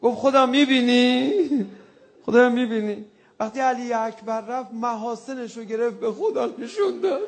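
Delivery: loud wailing mourning chant, voice breaking; rowzeh lament at full force. Acted. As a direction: cry out the lament to God, sobbing.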